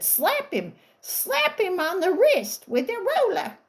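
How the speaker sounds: high, sharp teacher's order, mocking; mock shrill teacher. Spiteful teacher ordering a girl to hit him, high mock command.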